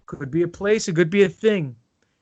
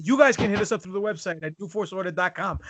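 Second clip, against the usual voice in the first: ordinary delivery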